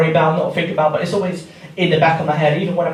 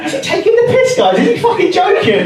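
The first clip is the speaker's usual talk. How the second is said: much higher, laughing disbelief; laughing outrage; 12 semitones higher, 4 dB louder than usual. Real, not acted. Comic asks the crowd, laughing in disbelief. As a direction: squeal it through the laugh.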